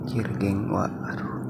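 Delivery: startled hushed whisper. Real, not acted. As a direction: breathe it out, spooked. Spooked entering abandoned house. (berbisik kaget)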